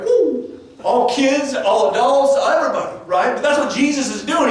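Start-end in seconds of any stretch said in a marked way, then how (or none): 0.0-0.6 high excited whoop; crowd's woo. Retold or imitated. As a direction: cheer it like the whole room. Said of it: "everybody went".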